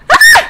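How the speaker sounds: very high, loud yelp; sudden piercing little shriek; short shriek. Real, not acted. Player hit in game, short high shriek.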